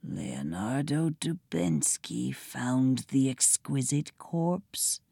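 hushed, low whisper. A narrator's reading. Pig breaking bad news to the children, whispered. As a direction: keep it soft and secret. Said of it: "the pig whispered".

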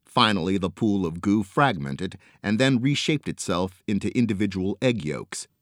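ordinary delivery, nothing marked

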